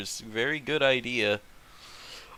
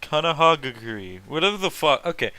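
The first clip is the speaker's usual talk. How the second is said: usual speaking voice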